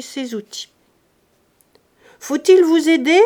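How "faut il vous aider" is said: loud, high call up the stairs. Acted. Servant calls to master hammering on the stairs, loud call. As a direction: shout up to be heard. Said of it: "lui cria Nanon".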